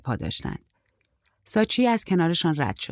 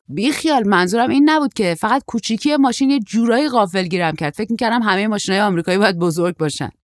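put-on higher, louder young man explaining himself; a hint of an eager boy, pitched up; lightly voiced apologetic surfer; 4 semitones higher, 6 dB louder than usual. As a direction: lightly play a young traveller backpedalling.

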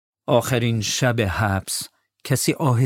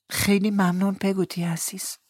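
put-on grateful child; 10 semitones higher than usual. Boy thanks Peggotty.

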